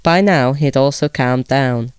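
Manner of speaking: ordinary delivery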